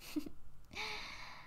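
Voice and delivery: short, hushed breath of a laugh; amused little puff; soft chuckle. Acted. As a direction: chuckle quietly under the breath. Amused vampire chuckles at human.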